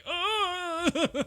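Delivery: high strained grunt; mock choking groan. Rabbi having a heart attack, mock strained gasp. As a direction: squeeze out a pained high gasp. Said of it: "He's just like".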